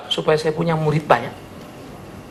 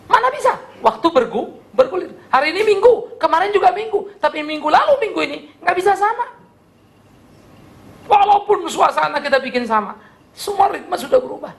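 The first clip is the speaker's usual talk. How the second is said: raised, pitch-high pulpit push; fervent preaching; 10 semitones higher than usual. Acted. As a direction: preach it out, climbing and urgent. Preaching at full force. (khotbah berapi-api dengan nada tinggi)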